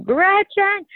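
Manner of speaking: high, loud yell of a name; retold scream. Husband yelling for wife at dawn, loud alarmed call. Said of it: "I hear him just, like, scream".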